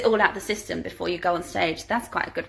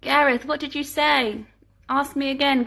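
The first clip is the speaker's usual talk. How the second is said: ordinary delivery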